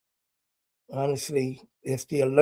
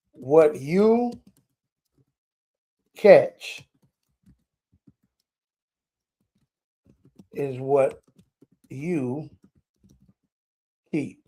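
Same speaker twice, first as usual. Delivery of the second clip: slow dictation; much slower than usual. Shown as a demonstration. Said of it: "I want y'all to catch that one".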